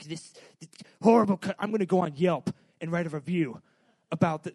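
loud, indignant customer threatening a bad review; angry customer. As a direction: blow up at the clerk about a review.

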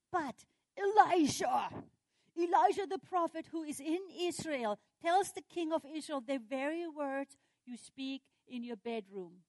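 loud, high cry on the prophet's name; emphatic revelation. Acted. Officer telling the king who the spy is, loud and emphatic.

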